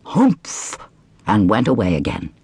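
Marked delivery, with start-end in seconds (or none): 0.0-0.8 high mocking snort; scornful camel grunt; camel humph. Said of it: "Then he said".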